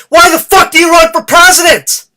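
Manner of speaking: furious, loud and high; angry shout. Real, not acted. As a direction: yell it at the president. Ranting at Trump.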